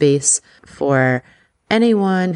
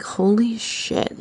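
drawn-out, higher and softer disbelief; stunned reaction; 7 semitones higher, 5 dB quieter, much slower than usual. Retold or imitated. Podcaster recalling her reaction to the news, stunned. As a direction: say it slowly in hushed amazement.

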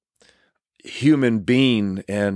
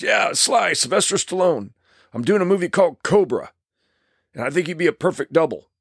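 higher, gravelly macho voice; lightly voiced gruff tough guy; imitating Terry Leonard; 7 semitones higher than usual. Terry asks the narrator on the phone, gravelly macho voice. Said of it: "he's got this gravelly voice".

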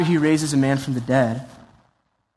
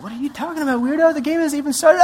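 confused crowd; 10 semitones higher, faster than usual. Pretend. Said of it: "Everyone's like".

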